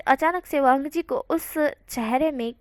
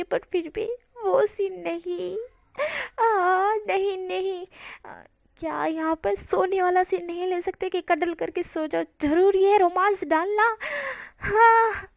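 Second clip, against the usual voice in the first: higher, half-crying no, no; childish mock wail, rising higher; whiny protest; 5 semitones higher than usual. Wang ji begging the scene to stop.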